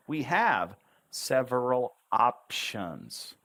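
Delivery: "we have several options" slow with stress on "have"; slow intoned line. Shown as a demonstration. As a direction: lean on "have", slowly. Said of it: "let's bring it to life".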